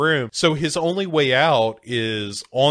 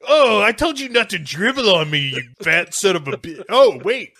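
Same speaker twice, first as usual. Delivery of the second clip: high, raised indignant outburst; mock angry scolding; 7 semitones higher, 4 dB louder than usual. [name] splashed, blames his friend, mock angry scolding. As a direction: tell him off loudly, then catch yourself.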